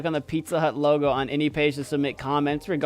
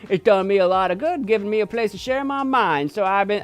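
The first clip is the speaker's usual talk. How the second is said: high put-on accent for a cheery nineties web guy; put-on accent as Justin; 6 semitones higher than usual. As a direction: play Justin with an odd invented accent, pitched up. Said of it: "I don't know why I've given him this a-, accent".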